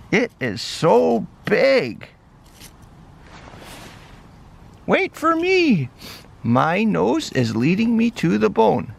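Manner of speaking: high, bright puppy voice, eager and friendly; high-voiced cheerful puppy; little dog voice. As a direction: play the small excited dog, pitched well up. Dog character excited about bone.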